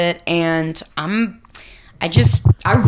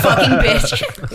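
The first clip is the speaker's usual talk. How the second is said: drawn-out cursing through laughter; hammed-up bitter curse; mock outrage; 3 dB louder, much slower than usual. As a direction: stretch out the insult, half laughing. Acts out angry Twilight Zone reader.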